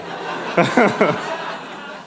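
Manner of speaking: breathy, mostly voiceless chuckle; laugh. Real, not acted. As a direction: chuckle at your own story. Laughs at his own story's ending.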